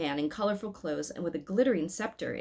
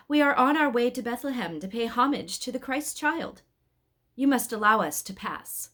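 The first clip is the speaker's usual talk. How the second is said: a slightly raised, louder angel voice; firm but kind, asking to pass; lightly voiced angel addressing the guards; angel speaking; 5 semitones higher, 4 dB louder than usual.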